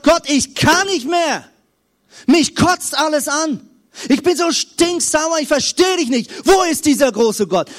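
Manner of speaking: auskotzen shouted up high and loud, half acted; mock angry outburst. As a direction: vent at God like King David, loud and raw. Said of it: "Auf den Putz haut".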